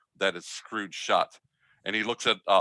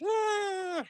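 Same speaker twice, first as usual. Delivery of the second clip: very high squeaky creature cry; tiny mite shriek; 4 dB quieter than usual. Acted. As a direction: squeak like a swarm of tiny mites. Dust mites shrieking.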